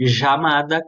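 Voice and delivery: chamada with the ll said like a Portuguese J, zha-mada. Shown as a demonstration. Teacher demoing one country's ll for students. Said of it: "como se fosse um J".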